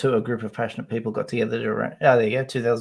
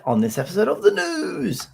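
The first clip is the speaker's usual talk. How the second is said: higher, slow mock-posh newsreader; 9 semitones higher, slower than usual. [name] doing the posh chip-eater newsreader intro, higher and slow. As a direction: announce the news like a plummy presenter. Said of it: "what do I say".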